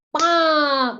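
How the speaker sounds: loud, drawn-out bang; crash sound. Retold or imitated. Teacher voices the car hitting the one in front, loud imitated bang.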